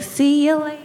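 high mock mom voice; high-pitched imitation of mom; mom impression. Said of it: "my mom's like".

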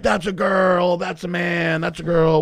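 mock crowd yelling guesses; crowd shouting. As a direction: shout guesses like a rowdy audience. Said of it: "the crowd would be like".

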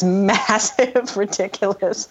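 normal talking voice

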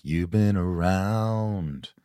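very slow, stretched repeat; slow, savouring echo; slow drawn repeat. Shown as a demonstration. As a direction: drag it out with feeling.

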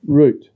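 "Route" as "root", for repeating; correct Aussie route. Shown as a demonstration. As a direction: teach "Route" with a long oo. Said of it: "Say it".

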